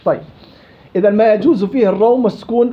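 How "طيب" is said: ordinary delivery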